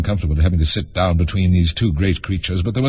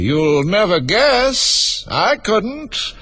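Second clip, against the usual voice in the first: high, gleeful, drawn-out tease; eager unicorn pitched high; 13 semitones higher, much slower than usual. A narrator's reading. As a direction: cry it eagerly, lingering on each word. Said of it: "the unicorn cried eagerly".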